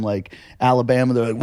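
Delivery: ordinary talk, nothing marked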